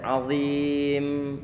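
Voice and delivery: azim with the m held and dragged; drawn-out final m. Shown as a demonstration. Teacher demonstrates stretching the mim in azim. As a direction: drag out the last m of azim. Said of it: "memnya antum tarik sedikit".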